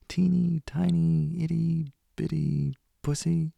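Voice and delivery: put-on high teasing baby talk; cutesy baby voice. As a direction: squeak it out mockingly. Mocking tiny cutesy words.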